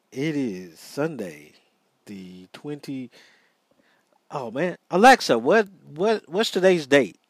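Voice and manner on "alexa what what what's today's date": raised voice, pitched up to reach the speaker; loud, high call to a smart speaker. Real, not acted. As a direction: call across the room to Alexa.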